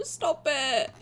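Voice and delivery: normal talking voice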